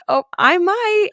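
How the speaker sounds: high, slow, teary half-laugh; choked-up admission. Real, not acted. Host admitting she may cry on stage, choked-up half-laugh. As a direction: admit it through a lump in the throat. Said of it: "I'm getting totally choked up".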